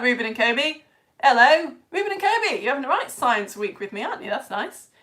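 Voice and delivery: ordinary talk, nothing marked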